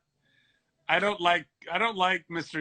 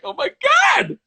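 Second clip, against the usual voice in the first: mock gasp of delight; 4 dB louder than usual. Mock excited reaction quote.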